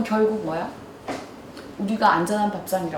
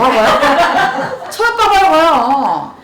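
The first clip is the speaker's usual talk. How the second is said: drawn-out slow laughing words; 4 semitones higher, 8 dB louder, much slower than usual. Real, not acted. To the audience about cooking, laughing slow. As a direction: laugh through it, slowly.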